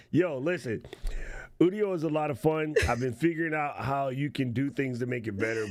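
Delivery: ordinary delivery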